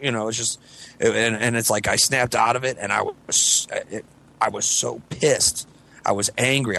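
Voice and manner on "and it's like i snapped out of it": usual speaking voice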